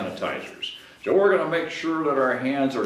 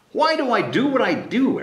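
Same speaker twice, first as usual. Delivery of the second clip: Carl asking the fox; 10 semitones higher than usual. Acted. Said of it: "asked Carl".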